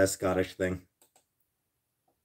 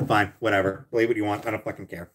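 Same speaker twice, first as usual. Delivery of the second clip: ordinary delivery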